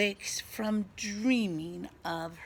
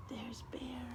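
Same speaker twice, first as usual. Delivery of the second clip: whispering; 5 semitones higher, 10 dB quieter than usual. Acted. Whispered aside.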